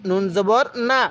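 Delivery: ordinary delivery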